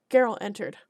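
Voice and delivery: plain reading voice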